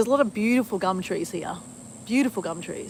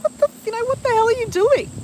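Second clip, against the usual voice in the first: mock scolding dad, pitched way up; imitating dad; 14 semitones higher, 6 dB louder than usual. Daughter imitating late dad scolding her for crying on his plaque, high mock scold. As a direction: playfully voice her father telling her off, high and indignant. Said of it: "He's like".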